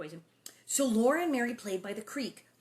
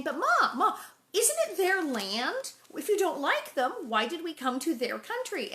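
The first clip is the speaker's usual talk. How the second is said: put-on louder, high child pleading; child challenging her mother; pleading Laura; 8 semitones higher, 6 dB louder than usual. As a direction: press Ma like a stubborn kid. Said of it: "she keeps saying".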